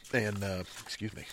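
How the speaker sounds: words round a sip of drink; talking between sips; while sipping a drink. Real, not acted. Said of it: "I'm drinking my Bloody Mary".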